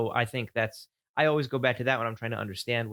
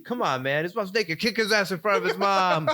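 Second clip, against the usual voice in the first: high, loud goading with laughs; mock jock; 9 semitones higher, 4 dB louder than usual. Host continues the jock goading Mike, high and laughing. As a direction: egg him on up high, laughing. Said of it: "the other guy's like".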